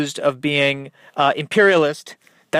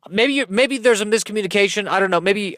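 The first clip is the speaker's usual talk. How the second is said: normal talking voice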